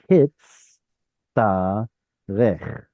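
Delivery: "hitz ta rech" split by clear pauses; syllable by syllable. Shown as a demonstration. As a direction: sound out "hitz", "ta", "rech" one at a time. Teacher sounding out new word.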